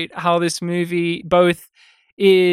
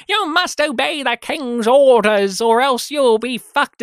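pompous British officer; 6 semitones higher, 3 dB louder than usual. Retold or imitated. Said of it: "he's just like".

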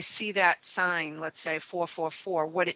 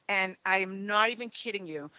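ordinary talk

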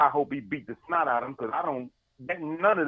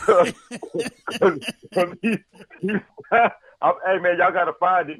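breaking up laughing, loud and high; can't get the words out for real laughter; laughing while talking; 5 semitones higher, 7 dB louder, slower than usual. Real, not acted.

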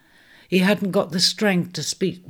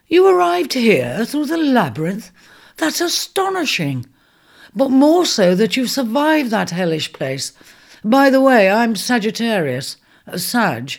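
louder, higher, awed centaur; amazed centaur; 6 semitones higher, 5 dB louder than usual. Acted. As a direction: marvel that the ram survived. Centaur amazed, introduces himself.

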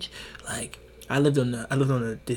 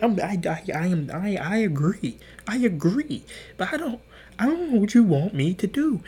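flustered placating; 8 semitones higher than usual. Retold or imitated. Acted placating reply to angry friend.

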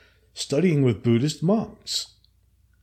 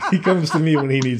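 cracking up at your own boast; laughing. Real, not acted. Joking to co-host about advising God, laughing. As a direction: laugh through the words.

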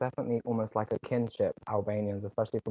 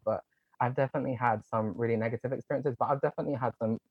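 ordinary delivery

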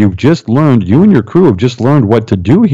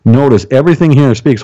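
usual speaking voice

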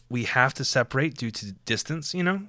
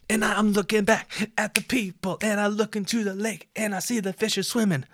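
high, silly put-on narration; goofy storytelling voice; 9 semitones higher than usual. Runner telling of befriending fishes, goofy high voice. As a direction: keep it pitched way up and childlike-absurd.

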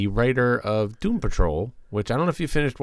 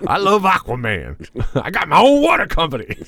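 Aquaman impression; 9 semitones higher, 7 dB louder than usual. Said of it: "Aquaman impression".

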